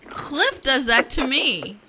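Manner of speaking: mock indignant. Mock-indignant complaint about husband.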